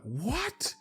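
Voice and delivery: whole class stunned at the news, high disbelieving what. Acted. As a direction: gasp it out in total disbelief. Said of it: "everyone being like".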